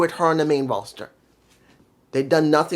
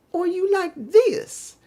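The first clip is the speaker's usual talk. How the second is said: high, slow and mocking, as if speaking down to him; slower than usual. Shown as a demonstration. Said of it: "I should reverse that".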